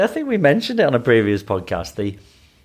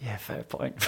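sheepish concession; 17 dB quieter, slower than usual. Retold or imitated. Conceding to colleague.

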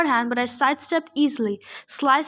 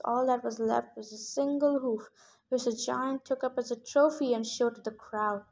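ordinary delivery

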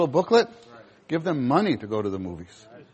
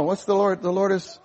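ordinary talk, nothing marked

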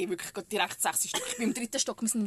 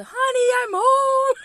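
slow, high, loud sitcom yell; hammed-up homecoming shout into a stranger's flat; mock shout; 13 semitones higher, 13 dB louder, much slower than usual. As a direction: belt it like a sitcom husband coming home. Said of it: "so wie raus, raus schreien".

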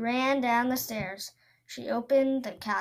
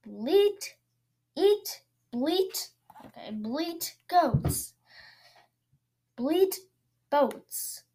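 high, odd gurgly monster voice; gurgling monster; 5 semitones higher, slower than usual. Acted. Tentacle monster burbling at the goat boy, gurgling. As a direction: bubble the words like a sea monster. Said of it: "said the monster gurgled".

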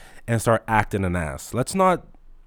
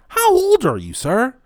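way higher, incredulous squeal; 12 semitones higher, 5 dB louder, slower than usual. Real, not acted. Clerk asking a clueless customer, high-pitched disbelief.

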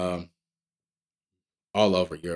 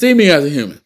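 louder, higher demand to be seen; loud pleading quote; 5 semitones higher, 11 dB louder, faster than usual. Retold or imitated. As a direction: shout it like a plea for respect.

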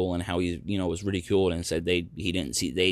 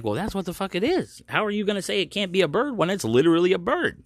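high-pitched, incredulous retort; exasperated disbelief; 10 semitones higher than usual. Retold or imitated. Arguing with the lady over the bird, exasperated.

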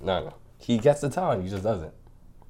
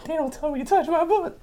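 put-on squeaky complaining kid; high child voice. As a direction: protest like a little boy. Child voice continues.

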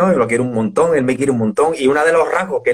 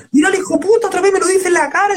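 much higher voice, laughing through the words; imitating Bustamante laughing. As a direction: mimic a friend cracking up at blunt honesty. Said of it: "y se harta de reír". (imitando a un amigo muerto de risa, voz mucho más aguda)

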